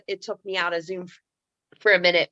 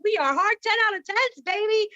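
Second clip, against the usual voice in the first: high-pitched celebratory boast; hyping up; 10 semitones higher than usual. Retold or imitated. Woman bonding with stranger in bathroom, hyping up. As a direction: cheer both of you on, pitched up.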